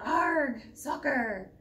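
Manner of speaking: loud pirate Arg cheer; crew yell. Acted. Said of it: "the crew yelled".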